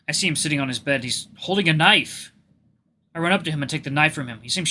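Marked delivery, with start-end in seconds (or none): none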